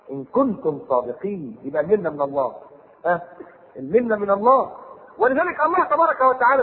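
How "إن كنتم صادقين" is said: slow chanted verse; Quranic recitation. Acted. Preacher reciting to congregation, slow chant. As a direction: intone the verse slowly.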